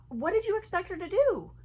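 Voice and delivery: mother asks her son in disbelief; exasperated. Real, not acted. Mom scolding son's story.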